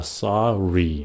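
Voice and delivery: SORRY drawn out slowly, falling; slow corrected "sorry" with a downward end. Shown as a demonstration. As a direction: stretch "sorry" and let it drop. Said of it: "Xuống giọng".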